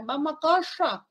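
high Vamos a calla with a curled-back s; retroflex s. Shown as a demonstration. Teacher demonstrating the retroflex s for the class. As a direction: say calla with the tongue tip curled up. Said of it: "Esta es una S retrofleja".

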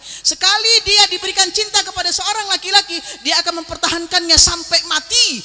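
high, forceful preacher's delivery; impassioned preaching. Real, not acted. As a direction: drive the warning home to the crowd. (ceramah lantang berapi-api)

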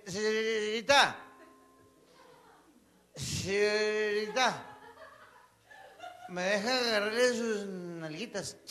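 put-on very slow, dragged and slurred drunk talk; drunk slurring. A drunk man begs the girl, slow and slurred. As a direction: drag every word out like a drunk. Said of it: "pedo no habla uno bien".

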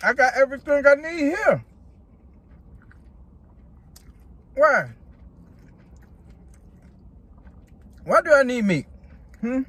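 chewing while talking; speaking between bites; mouth full. Real, not acted. Seen 2: holds burger at mouth, then chews with lips moving throughout.

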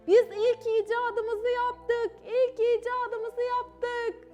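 high, drawn-out triumphant shout; children proudly calling across a schoolyard; loud singsong announcement, stretched slow. A narrator's reading. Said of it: "Hemen onlara seslendiler".

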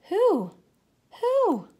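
high, loud owl-like who; owl hoot. Acted. Child pretending to be owl, high hooting who. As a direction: hoot it like a wide-eyed owl. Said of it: "you ask".

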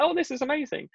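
high, gushing delight. Retold or imitated. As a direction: swept up in the joy. Then loving the attention.

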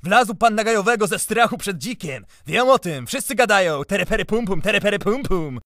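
high, shouted, out-of-control jeering; enraged mocking. A narrator's reading. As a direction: taunt loudly, losing all self-control. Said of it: "Przedrzeźniał, tracąc w gniewie resztę panowania".

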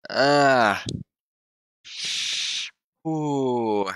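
long groans of burning pain. Real, not acted. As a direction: groan through a burning mouth. Burning mouth from the hot chip.

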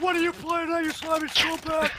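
high mock voice. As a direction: stretch out the insult in a squeaky voice. Mocking a player in game chat.

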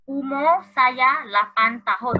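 slow, loud, spaced Malay sentence; slow clear reading. Shown as a demonstration. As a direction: read it slowly so pupils catch every word. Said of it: "老师会念是".

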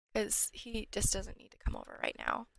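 normal talking voice

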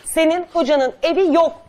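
loud scolding shout; angry shout. Real, not acted. Host shouting at the lying guest, loud and exasperated. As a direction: bellow it at her, fed up.

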